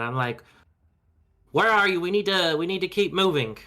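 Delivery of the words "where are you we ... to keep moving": raised, higher-pitched yell to someone out of sight; calling out. Acted. Player's character calls for the missing tiefling, yelling out. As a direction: shout it out to find him. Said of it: "I yell his name out".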